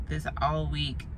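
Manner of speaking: usual speaking voice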